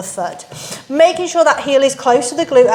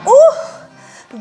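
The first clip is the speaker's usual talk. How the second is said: excited exclaim; 14 semitones higher, 4 dB louder than usual. Real, not acted. End of hard set.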